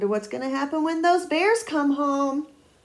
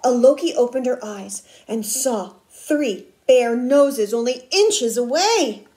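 emphatic read-aloud with punched words; dramatic picture-book telling; suspenseful story narration; 6 dB louder than usual. A narrator's reading. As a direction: build suspense for young listeners.

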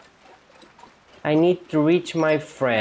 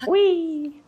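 long, high-pitched whee; gleeful squeal. Real, not acted. Player riding along in the game, gleeful high squeal. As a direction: squeal with delight on a ride.

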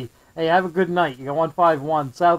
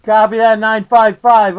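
loud radio call; 3 semitones higher, 8 dB louder than usual. Real, not acted. Calling out over CB radio.

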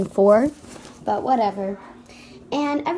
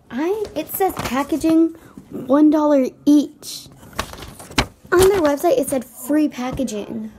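outraged; 3 semitones higher, 5 dB louder than usual. Real, not acted. Buyer discovers packaging charge.

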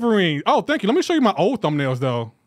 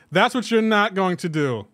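normal talking voice

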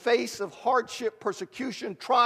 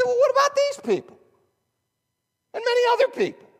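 much higher and louder, mock disbelief; incredulous retort; 7 dB louder, faster than usual. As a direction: squeak out the question in disbelief. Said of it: "And you, I said".

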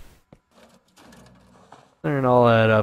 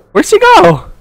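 panicked yelling; 14 dB louder than usual. Real, not acted. Monster vanished after scare.

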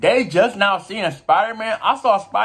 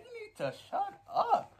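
high mock scolding; 12 dB quieter than usual. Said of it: "She said".

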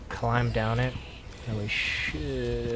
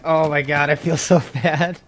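laughing guiltily; 3 semitones higher, 7 dB louder than usual. Real, not acted. Laughing after killing a player.

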